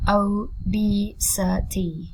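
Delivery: "obesity" slowly in four beats, O, BE, SI, TY; slow teaching breakdown of "obesity"; syllable by syllable. Shown as a demonstration. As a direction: draw out each syllable of "obesity".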